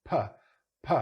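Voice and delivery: isolated popped "puh" from the lips; unvoiced P. Shown as a demonstration. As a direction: pop the P with no vibration. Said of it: "There's no vibration".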